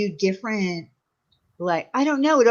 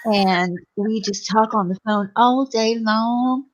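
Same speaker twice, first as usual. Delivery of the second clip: slow, drawn-out Southern drawl; put-on Southern belle drawl, unhurried; Southern accent; slower than usual. Shown as a demonstration. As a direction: stretch every phrase in a syrupy slow Southern drawl. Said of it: "I could do a Southern accent".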